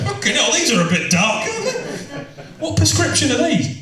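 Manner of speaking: high mock-bewildered dad voice, laughing; a laughing dad squinting through women's sunglasses; dad in borrowed sunglasses. Dad in sunglasses asks his son about the prescription, mock-bewildered. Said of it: "looking like [name] going".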